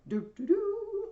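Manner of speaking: sung high, held trumpet "doo"; trumpet blast imitation. Shown as a demonstration. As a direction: mimic an introductory fanfare with the voice. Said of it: "it means a trumpet blast".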